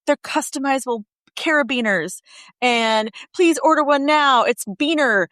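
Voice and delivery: mock excited plug for her product; excited sales pitch. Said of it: "she was so excited about her new business".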